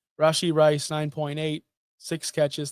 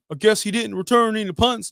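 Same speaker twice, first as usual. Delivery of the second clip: higher, put-on Florida accent; 5 semitones higher than usual. Shown as a demonstration. Fantasy host mocking a player's fans in a Florida accent. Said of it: "doing my Florida accent".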